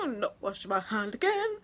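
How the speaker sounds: dreamy starstruck sigh; swooning sigh. Retold or imitated. Swooning after touch.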